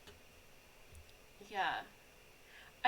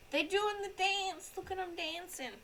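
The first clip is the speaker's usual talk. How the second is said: cutesy pet talk; 10 semitones higher, 6 dB louder than usual. Acted. Streamer cooing at chonk, high baby-talk voice. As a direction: coo at the pet like it's a baby.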